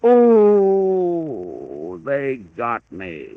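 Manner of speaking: slow, fading "they got me"; dying groan. Acted. Pappy, shot at last, groaning as he goes. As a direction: sink down slowly as the shot hits.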